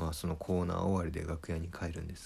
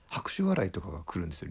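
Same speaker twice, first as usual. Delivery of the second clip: ordinary talk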